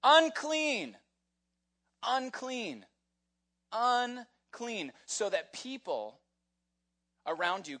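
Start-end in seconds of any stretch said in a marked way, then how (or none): 0.0-4.9 high, loud warning cry with falling end; shouting. Retold or imitated. Leper warning people to back away, shouting. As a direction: holler it out as a repeated warning, pitched way up. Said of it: "you had to walk out of there shouting".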